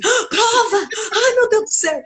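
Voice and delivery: high, mock panicked little boy; panicked child. Teacher imitating a child panicking about a test. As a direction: squeal like a kid dreading a test. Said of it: "aí o menino falou assim".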